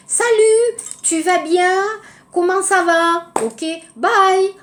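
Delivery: bright high casual hello to a close friend; casual greeting. Shown as a demonstration. Teacher showing informal greeting between friends, breezy and high. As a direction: toss it off lightly, like to a buddy. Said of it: "avec les amis".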